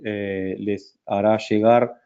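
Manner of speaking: ordinary talk, nothing marked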